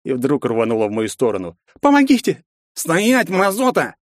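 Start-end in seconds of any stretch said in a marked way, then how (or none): 1.8-2.5 cry for help. Acted. Girl runs to hero crying for help. 2.7-4.0 barked order. Acted. Redhead barks at the fleeing girl to stop. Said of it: "рявкнул рыжий".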